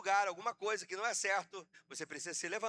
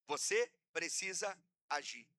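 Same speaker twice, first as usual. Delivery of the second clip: deliberate, with a pause after each word; 4 semitones lower than usual. Real, not acted. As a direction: spell out VOCÊ, PRECISA, AGIR as separate beats. Repeats it word by word.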